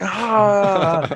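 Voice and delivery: high, drawn-out "ahh" laugh; acted laugh. Player's character slapping tail on the table, acted drawn-out laugh. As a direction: sigh out the end of a mock laugh. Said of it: "and I'm like".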